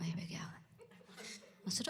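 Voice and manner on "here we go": under-the-breath whisper with a laugh; muttered whisper. Retold or imitated. Comedian whispering his weary thought while the woman confides. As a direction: whisper it to yourself, resigned.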